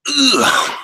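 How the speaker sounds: short, high-pitched ugh; high groan. Real, not acted. Speaker before admitting going nutty, high groan. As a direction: let out a quick high groan.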